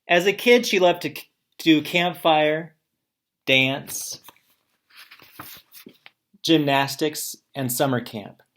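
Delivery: loud, clear read-aloud to the class; teacher reading a student's paper aloud to the class, loud and clear. Acted.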